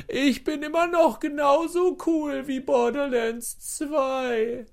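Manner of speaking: very high, drawn-out mock yell; mock scream. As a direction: squeal it slowly like a desperate boast, without real volume. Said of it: "geschrien hat". (hohes, langgezogenes gespieltes Schreien)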